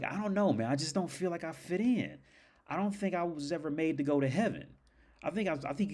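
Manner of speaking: high, put-on voice of misgiving; imitated doubting robber. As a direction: act out unease in a high pitch.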